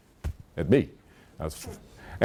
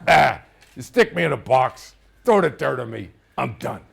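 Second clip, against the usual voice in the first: loud, cigar-mouthed dad dismissing death; dad impression; 2 semitones higher, 4 dB louder than usual. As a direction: imitate dad waving it off, punchy then dropping. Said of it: "almost perfectly my dad".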